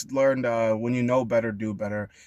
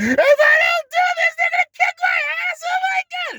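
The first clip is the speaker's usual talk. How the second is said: panicked scream; 10 dB louder than usual. Retold or imitated. Voicing the fearful learner, high panicked screaming. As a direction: scream as if your life depends on it. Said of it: "You learned it out of".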